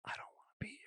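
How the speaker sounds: retold hushed whisper to her. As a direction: whisper it so the cameras can't hear. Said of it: "whisper to her 'cause the cameras were behind me".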